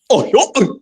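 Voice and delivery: high, put-on cartoon voice; Goofy. Answering a viewer's request for an impression, high cartoon yelps. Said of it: "Make a goofy impression".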